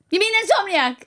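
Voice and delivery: imitating Chris correcting the driver; mock correction. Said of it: "he went".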